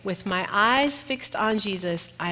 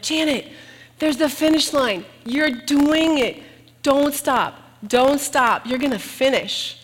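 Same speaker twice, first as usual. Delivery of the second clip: higher and louder, urging a tired runner on; cheering on; 6 semitones higher, 6 dB louder than usual. Retold or imitated. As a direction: cheer her up the last hill. Friends cheering at finish line.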